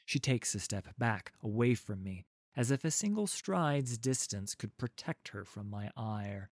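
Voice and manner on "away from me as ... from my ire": plain reading voice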